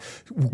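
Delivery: mock roar of a crowd going nuts; imitated crowd roar. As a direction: roar like a whole riled-up audience.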